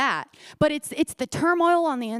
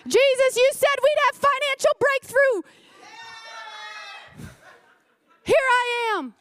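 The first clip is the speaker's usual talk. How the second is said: high, pleading complaint to Jesus; whiny complaining believer; 10 semitones higher than usual. Retold or imitated. Preacher acts a believer complaining to Jesus, high and whiny. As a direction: whine at Jesus like a let-down child. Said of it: "we can be a lot like this".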